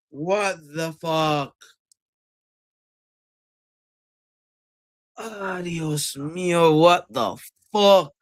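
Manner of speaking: exasperated outburst. Real, not acted. Reacting to a bad play.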